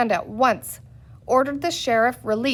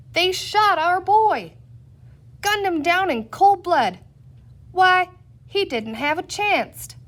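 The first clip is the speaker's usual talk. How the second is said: angry cowboy mob; 6 semitones higher than usual. A narrator's reading. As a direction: rouse the crowd like a lynch mob in a western. Angry crowd of cowboy voices.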